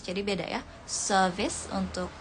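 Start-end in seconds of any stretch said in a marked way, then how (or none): 0.9-1.7 British "service", r left silent; British accent. Shown as a demonstration. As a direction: say "service" with a British long vowel, no r. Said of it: "untuk British dan". (logat British)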